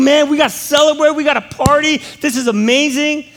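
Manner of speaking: high, loud whoop of relief; excited celebration. Retold or imitated. Pastor recalling his joy at finishing the dissertation, loud and high. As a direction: cheer like the work is finally done.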